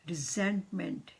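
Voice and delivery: ordinary talk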